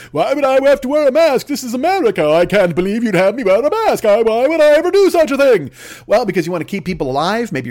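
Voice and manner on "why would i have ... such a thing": whiny, high entitled shopper; mock her outrage with a raised, pinched voice; hammed-up indignant Karen; entitled customer. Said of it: "they're like".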